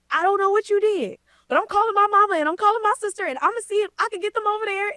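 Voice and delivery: high, fast excited rush; crazy reaction. Retold or imitated. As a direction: imitate his over-the-top excited reaction. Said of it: "he had the most crazy reaction".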